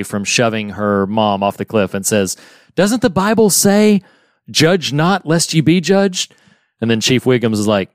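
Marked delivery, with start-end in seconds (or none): 2.8-6.3 higher-pitched, lightly acted earnest plea; pleading Lisa. Lisa pleading with townsfolk at the cliff, pleading. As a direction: quote the verse as an earnest objection.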